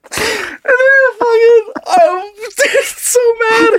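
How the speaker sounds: high, slow wheeze through laughter; real laughing fit stretching every word; helpless laughter. Real, not acted. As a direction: barely get the words out while cracking up. Helpless laughter telling the story.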